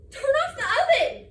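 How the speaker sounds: high, loud urgent shout; frantic mother's command; alarmed mom yelling. Acted. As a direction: yell the order to another room. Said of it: "My mom thinks I should say".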